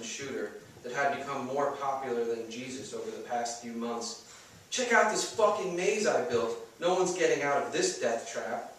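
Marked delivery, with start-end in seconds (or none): 4.7-8.7 proud boast. Acted. Narrator brags about maze.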